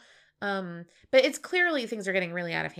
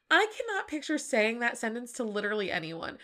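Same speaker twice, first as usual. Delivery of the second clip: ordinary delivery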